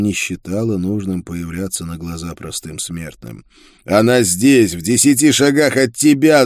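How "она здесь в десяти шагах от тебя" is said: loud, raised warning yell. A narrator's reading. Prisoner warns Isolde the creature is near, yelling. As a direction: bellow an urgent warning. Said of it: "заорал я".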